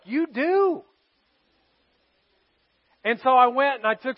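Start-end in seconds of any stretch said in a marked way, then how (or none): 0.0-0.8 wife's quip. Retold or imitated. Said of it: "And Jen was like".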